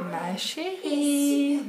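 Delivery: high, slow, drawn-out ghostly call; eerie voice. Retold or imitated. Hearing a ghostly voice at night, eerie slow call. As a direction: stretch it out like a phantom calling in the night. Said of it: "que j'entends".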